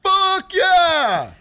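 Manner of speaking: shouted, high, hammed-up cheer; mock cheer. Staff cheering a dumb idea, mock shouted cheer. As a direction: yell it like a pumped-up brainstorm crowd.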